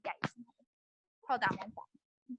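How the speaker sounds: ordinary delivery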